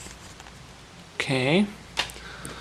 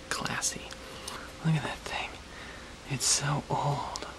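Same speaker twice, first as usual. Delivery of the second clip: whisper; 13 dB quieter than usual. Real, not acted. Whispering about old cameras.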